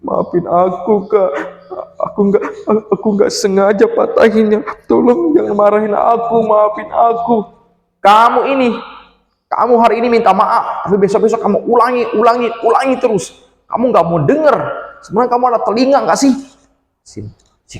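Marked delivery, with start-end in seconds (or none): 0.0-7.4 pleading little brother. Acted. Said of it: "Si adiknya hanya duduk". 8.0-16.3 angry sister scolding. Acted. Sister scolding the little brother.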